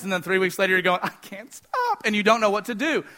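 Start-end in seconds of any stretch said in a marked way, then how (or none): 1.0-2.1 high, soft and slow whimper; much higher, slow, self-pitying wail; whiny mock lament. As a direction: drag it out like a helpless whine.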